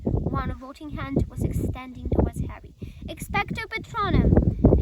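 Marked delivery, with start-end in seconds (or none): 3.1-4.8 shouted high and loud; yelled spell. A narrator's reading. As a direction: yell the spell with all his might. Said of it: "Harry yelled".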